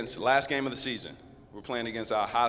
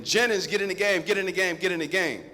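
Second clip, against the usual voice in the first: louder, higher coach calling a player; coach yelling; 6 semitones higher, 3 dB louder than usual. Retold or imitated. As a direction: bark the name, then fade off the repeats. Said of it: "The coach is screaming".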